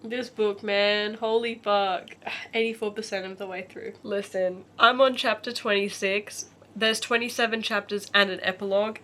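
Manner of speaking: ordinary delivery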